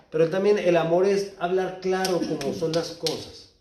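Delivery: ordinary talk, nothing marked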